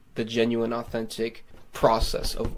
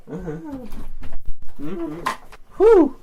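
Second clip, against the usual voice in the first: strained vocal noise; 10 dB louder than usual. Real, not acted. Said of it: "that screaming took it out of me".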